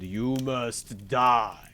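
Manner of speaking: very slow, drawn-out pronouncement; menacing sentence. A narrator's reading. Bluebeard condemning his wife to death, slow menace.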